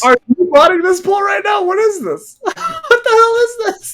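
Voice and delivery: high-pitched disbelieving outcry; incredulous. Real, not acted. Host accuses pitcher of botting the poll, high and incredulous. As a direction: voice shoots up in baffled disbelief.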